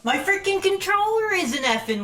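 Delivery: high-pitched exasperation at failing gear; frustrated outburst. Real, not acted. Streamer fuming that his controller isn't working, exasperated.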